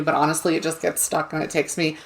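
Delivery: ordinary delivery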